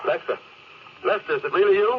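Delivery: can't believe it, grab onto hope; lost captain hearing his crewman on the radio, amazed; amazed relief. Acted.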